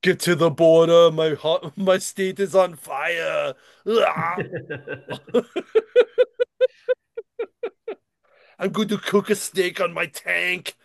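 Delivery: a hint of a hammed-up Austrian governor; Arnold impression. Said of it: "the governator".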